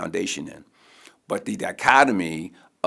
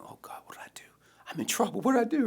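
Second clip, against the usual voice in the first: panicked inner thought; 3 dB quieter, much faster than usual. Retold or imitated. Panicked inner thought after teachers call him.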